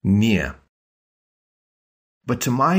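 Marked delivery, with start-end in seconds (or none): 0.0-0.5 "Nie" like niet minus the T; full nyeh. Shown as a demonstration. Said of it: "So it sounds like "niet", but without the T".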